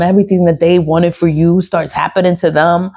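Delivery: ordinary delivery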